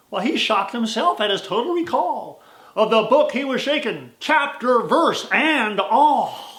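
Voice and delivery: rhythmic storytelling cowboy verse; poem recitation. A narrator's reading.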